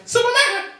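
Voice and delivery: high, loud desperate scream; screaming for help. Acted. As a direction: shriek for rescue from the flames. Woman screaming from burning building.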